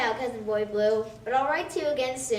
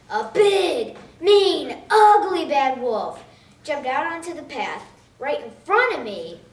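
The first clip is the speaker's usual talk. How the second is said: big, loud storyteller emphasis; loud dramatic storybook build-up; emphatic loud; 8 dB louder, slower than usual. A narrator's reading. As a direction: punch out the scary wolf words. Dramatic description of the wolf.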